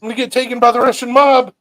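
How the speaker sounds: high, loud mock panic; panicked yelp. Telling friends about the knife cut, a loud high mock panic. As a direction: squeal it out in mock terror. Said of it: "I was like".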